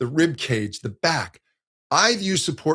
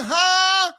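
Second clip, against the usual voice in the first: high, louder, wide open ha shout; pushed wide ha; 4 dB louder than usual. Shown as a demonstration. Teacher shows the wrong pushed sound, a shouty ha. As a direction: push the ha wide and heavy. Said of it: "as opposed to that push".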